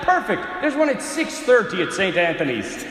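loud, high, pouncing Irish priest; mock Irish confessor, emphatic; Irish accent. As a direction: pounce triumphantly with a hint of Irish. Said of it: "gonna do my best Irish impersonation".

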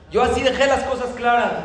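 mandona, más aguda y fuerte; firme y tajante. Acted. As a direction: deja claro quién manda, con voz alzada. Wife boasts how she set rules. (tono firme de esposa mandona)

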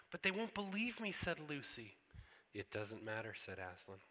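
storybook reading with voiced characters; story reading. A narrator's reading. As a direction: read it aloud like a bedtime story for the congregation. Pastor reads Narnia story aloud.

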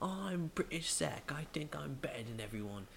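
mock him in a terrible fake British accent; British Zach. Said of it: "it was the worst British accent on purpose".

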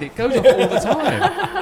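laughing. Real, not acted. Laughing about the klaxon in his head.

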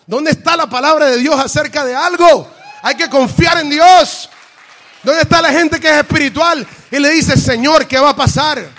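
loud, high, shouted preaching; forceful challenge. Real, not acted. Preacher challenging congregation to trust God, impassioned shout. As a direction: cry out the challenge at full force.

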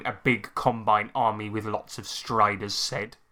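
normal talking voice

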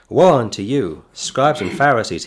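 loud, thundering rebuke; denouncing. Acted. Jesus rebuking the scribes and Pharisees, loud denunciation. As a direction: hurl the woe at them like a judgment.

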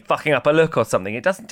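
ordinary delivery

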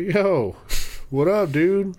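high, eager "yo, what up"; excited greeting. Retold or imitated. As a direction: greet a celebrity like a buddy. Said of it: "I'd probably walk up and say".

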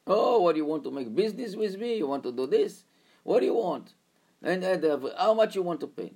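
high, loud sneering driver; mocking driver. Driver mocks the student's offer, high and loud. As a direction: taunt him about doing business.

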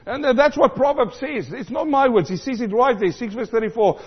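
ordinary talk

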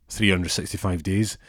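ordinary talk

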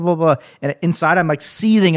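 normal talking voice

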